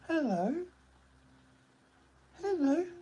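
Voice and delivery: high sing-song hello; pet voice. Real, not acted. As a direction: coo it high to the dog. High voice greeting the dog.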